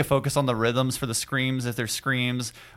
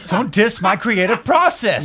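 mock indignant artist; 9 semitones higher, 6 dB louder, slower than usual. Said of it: "And I'm like".

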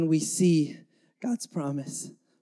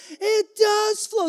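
excited exclaim; 11 semitones higher, slower than usual. Retold or imitated. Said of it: "There's an exclamation point".

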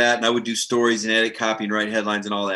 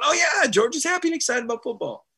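mock excited writer; 12 semitones higher than usual. Beat writer mirroring happy coach.

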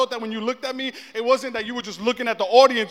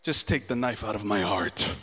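much lower, hammed-up wounded groan; mock heartbroken; 14 semitones lower, 5 dB quieter than usual. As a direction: play the heartbreak for laughs. Heartbroken aside after rejection.

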